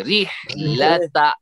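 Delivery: रिहलता stretched slow, ra kept thin. Shown as a demonstration. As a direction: say रिहलता slowly with a fine light ra. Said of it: "जोरों से मिलाकर इसको बारीक करेंगे". (धीमे, बारीक रा वाला रिहलता)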